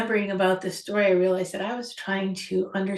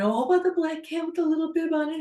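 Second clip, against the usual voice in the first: excited gushing; 8 semitones higher, faster than usual. Retold or imitated. Man gushing over cats.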